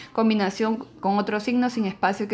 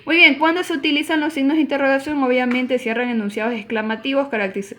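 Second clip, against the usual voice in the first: ordinary talk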